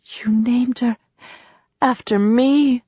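breathless, trembling whisper of disbelief; a grandmother overcome, whispering her thanks; tearful whisper. A narrator's reading. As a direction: barely get the words out, moved. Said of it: "His mother's lips trembled".

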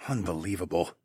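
low, soft disbelieving mutter. A narrator's reading. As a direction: mutter it under your breath. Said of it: "I muttered".

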